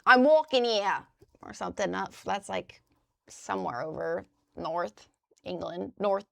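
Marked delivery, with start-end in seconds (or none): 0.0-1.0 put-on loud, a louder "I'm walking here" in a New York accent. Streamer imitating a New England accent for chat, New York accent. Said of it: "there's, like".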